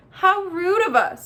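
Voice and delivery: high, loud feigned apology; mock politeness. A narrator's reading. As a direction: exclaim with exaggerated courtesy. Sarcastic bear feigns courtesy.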